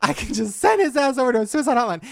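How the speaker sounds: high, loud indignant retelling; outraged disbelief. Real, not acted. Host telling co-host about the hotline transfer, high indignant outburst. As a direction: rant it high and scandalized.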